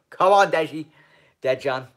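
loud, high cheer for a player; rally him like a fan in the stands; excited cheer. Real, not acted. Cheering on Lovren to win.

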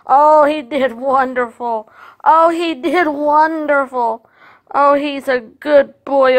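loud, slow, drawn-out gushing; gushing praise. Real, not acted. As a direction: praise the dog loudly, stretching it out. Gushing praise of her dog.

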